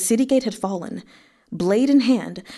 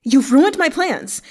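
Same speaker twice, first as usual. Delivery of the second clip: higher, louder furious outburst; furious snap; 6 semitones higher, 5 dB louder than usual. Acted. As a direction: spit out the accusation. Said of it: "he snapped".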